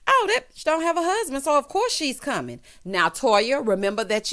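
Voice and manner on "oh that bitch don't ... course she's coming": catty quote. Retold or imitated. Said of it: "So Toya said".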